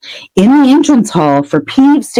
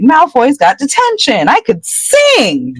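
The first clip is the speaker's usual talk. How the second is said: gleeful; 9 semitones higher, slower than usual. Retold or imitated. Hermione dancing a jig.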